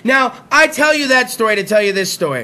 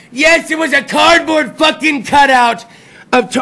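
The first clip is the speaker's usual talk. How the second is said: ordinary talk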